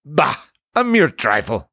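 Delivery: dismissive scoff. A narrator's reading. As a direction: brush it off with mild scorn. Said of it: "scoffed Gaston".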